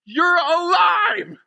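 slow, high, tearful shout; overcome cry. Acted. Mary realizing Jesus is alive, tearful shout. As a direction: shout it out slowly, overcome with emotion.

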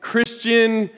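preacher mocking a misreading of Peter with fake pleasure; mock sadomasochist. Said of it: "as if Peter was saying".

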